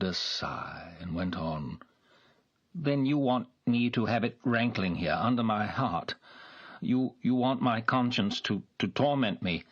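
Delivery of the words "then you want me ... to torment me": higher and louder, conscience-stricken; anguished gentleman. A narrator's reading. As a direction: let the guilt spill out. Nikolai voiced, anguished.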